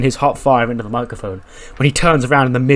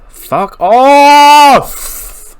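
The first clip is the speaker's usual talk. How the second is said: much higher and much louder, blown-out yell; real furious shout; 13 semitones higher, 10 dB louder than usual. Real, not acted. A player furious that his champion is injured, shouting. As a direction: explode at the screen.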